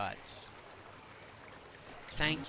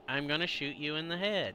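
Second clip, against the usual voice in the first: ordinary delivery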